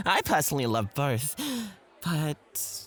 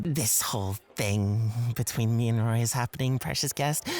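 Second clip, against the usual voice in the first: ordinary talk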